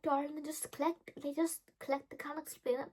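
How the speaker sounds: put-on calm the child down; soothing adult. Paula soothes the upset teen, soft and gentle. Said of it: "she's like".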